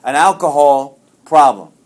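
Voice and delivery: loud and slow, leaning on "alcohol problem"; emphatic correction. Real, not acted. Correcting himself before the meeting, loud and slow emphasis. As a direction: land "an alcohol problem" firmly and slowly, correcting the slip. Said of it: "let me rephrase that".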